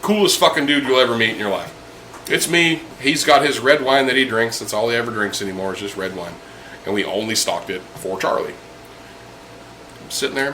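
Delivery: ordinary talk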